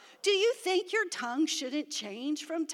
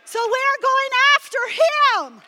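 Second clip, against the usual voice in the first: high, loud, slow preaching shout; rallying shout; 9 semitones higher, 11 dB louder than usual. Real, not acted. Pastor rallying the congregation, shouting. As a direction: fire the church up to go after him.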